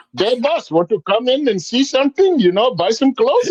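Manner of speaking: high-pitched mock Indian accent; imitated Indian accent. Storyteller as shopkeepers inviting him in, imitated Indian accent. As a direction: hawk your wares in a hammed-up Indian accent. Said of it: "Why are they using my voice?".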